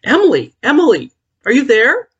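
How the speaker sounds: high, loud calling from outside; urgent high calling out to Emily. Acted. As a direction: call her name across the dark.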